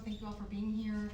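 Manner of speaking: ordinary delivery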